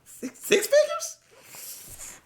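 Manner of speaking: very high, very slow six figures; high drawn-out gasp at the sum; squeaky disbelief. Acted. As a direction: squeak it out, stunned by the money. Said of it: "I start going like this".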